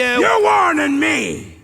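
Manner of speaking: loud, slow mocking outburst; incredulous shout. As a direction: bellow it back in disbelief. Mocking threat back, shouted.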